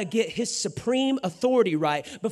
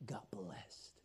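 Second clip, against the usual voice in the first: breathy hushed whisper; 12 semitones lower, 21 dB quieter than usual. Real, not acted. Preacher whispering the payoff to the congregation. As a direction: drop to a whisper.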